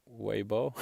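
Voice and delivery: HUEVO said as WAY-bo, no H; silent H. Shown as a demonstration. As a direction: drop the H in huevo, WAY-bo. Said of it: "you just totally forget about the H".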